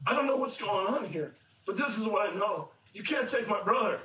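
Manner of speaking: firm, pressing appeal; insistent plea. Retold or imitated. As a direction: plead while standing your ground. Judah insists on saving his brother.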